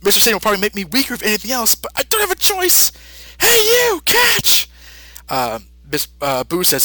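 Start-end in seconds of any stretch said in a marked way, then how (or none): 0.0-4.7 high, fast cartoon Goku voice. Goku calling to Mister Satan, high and urgent. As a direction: rush the thought, then call out high. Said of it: "thinking to himself".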